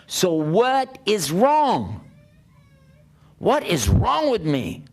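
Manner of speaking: slow anguished asking. Retold or imitated. Recalled despair, questioning God.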